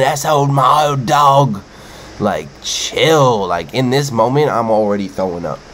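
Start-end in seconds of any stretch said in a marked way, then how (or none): 0.0-1.6 slow, loud mock Aussie call; mimicking the excited Aussie. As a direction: lightly imitate the thrilled paddler calling his snake. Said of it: "He kept calling".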